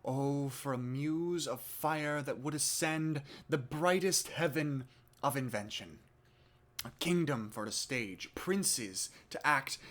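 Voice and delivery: declaimed stage prologue with strong surges on key words; rally the audience's imagination, measured and theatrical; Shakespeare monologue. Acted. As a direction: perform it as a Shakespearean Chorus addressing the house. Performs Shakespeare monologue in rehearsal.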